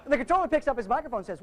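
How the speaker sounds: ordinary talk, nothing marked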